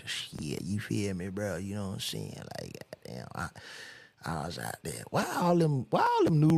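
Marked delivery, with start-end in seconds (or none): 0.1-5.0 low, slurred rapper mumble; mock incoherent rappers, swallowing the words; lazy, half-audible parody of rap talk; mumbling rapper impression. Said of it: "talks like this".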